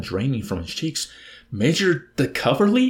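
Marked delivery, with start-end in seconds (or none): 1.5-2.9 high, loud astonished question; shocked cry. A narrator's reading. Nately gasps at learning who was hit, crying out in amazement. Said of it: "he cried".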